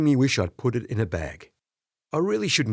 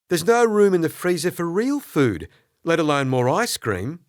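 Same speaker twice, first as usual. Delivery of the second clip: exasperated scolding; 6 semitones higher, 4 dB louder than usual. Acted. Sadie scolding.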